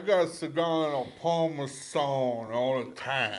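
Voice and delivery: slow, hammed-up New York Italian; New York Italian accent. Guessing how co-host's neighbor talks, mock New York Italian accent. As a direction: caricature an Italian New Yorker, drawn out. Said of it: "he's Italian as shit".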